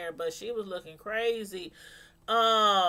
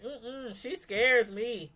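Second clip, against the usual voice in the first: ordinary talk, nothing marked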